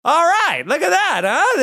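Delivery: high, loud, delighted exclamation; chuffed delight. Retold or imitated. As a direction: crow it out, flattered and thrilled. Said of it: "you're like".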